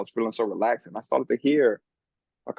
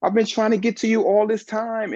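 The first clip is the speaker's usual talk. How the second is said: higher, louder, slow loving mother voice; a mother at last heard, slow and raised; voicing his mother; 8 semitones higher, 6 dB louder than usual. Mother calling to her son at last, higher and louder. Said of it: "And she's like".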